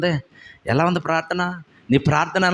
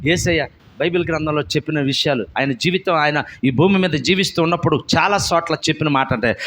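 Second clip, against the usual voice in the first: usual speaking voice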